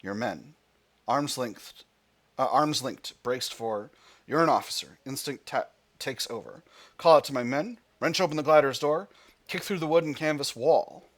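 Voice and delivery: tense read-aloud narration; story narration. Acted. Player narrating the glider crash, story narration. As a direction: carry the listener through the crash.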